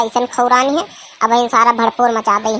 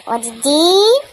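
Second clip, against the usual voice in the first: ordinary talk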